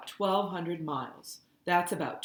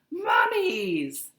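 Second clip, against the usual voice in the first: loud high excited shout; 7 semitones higher, 9 dB louder than usual. A narrator's reading. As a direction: yell it gleefully while jumping.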